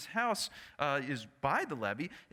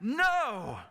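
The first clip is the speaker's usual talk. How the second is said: angry yell; 3 dB louder, slower than usual. Retold or imitated. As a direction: yell back, ticked off, at the chopper. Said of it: "the faithful man yells back".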